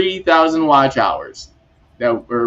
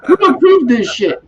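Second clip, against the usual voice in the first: mock outrage; 11 semitones higher than usual. Retelling his own shocked reaction.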